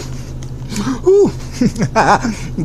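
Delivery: high breathy laugh. Real, not acted. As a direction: laugh through the heat. Laugh after spicy bite.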